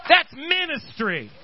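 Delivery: driving, unbroken pulpit delivery; fervent preaching. Real, not acted. Preacher urging the church to fight for others, fervent preaching. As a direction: preach it with mounting urgency.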